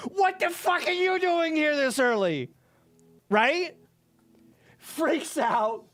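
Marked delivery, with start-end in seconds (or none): 0.1-2.5 cursing shout. Retold or imitated. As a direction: yell it at the intruder, caught off guard. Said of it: "curses me out".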